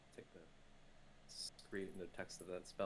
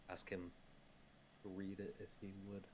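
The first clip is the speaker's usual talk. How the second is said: usual speaking voice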